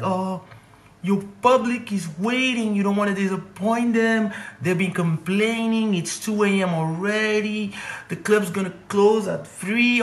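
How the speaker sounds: high, slow, pleading crook voice; mock emotional plea. Crook guilting the comedian into performing, hammed-up plea. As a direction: lay the guilt on thick, high and drawn out. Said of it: "the emotional vibe".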